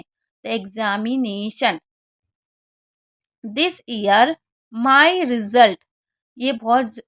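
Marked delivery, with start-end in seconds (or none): 0.5-1.8 "एग्जामिनेशन" drawn out, x as gz; slow model word. Shown as a demonstration. Teacher models "एग्जामिनेशन" slowly for students. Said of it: "एक्स को गज पढ़ते हैं". 3.4-5.8 drawn-out reading of the sentence; very slow read. Shown as a demonstration. Teacher slowly reads the example sentence aloud. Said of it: "reads example sentence".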